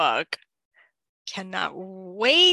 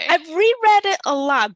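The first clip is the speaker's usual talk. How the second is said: emphatic; 9 semitones higher, 8 dB louder than usual. Real, not acted. Defensive about rereading.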